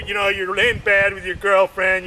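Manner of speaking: normal talking voice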